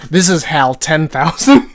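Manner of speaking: loud laughing announcement; excited. Real, not acted. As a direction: proclaim it gleefully. Excited naming the character.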